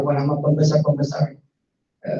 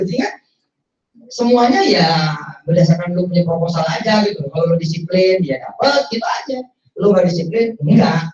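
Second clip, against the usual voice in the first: normal talking voice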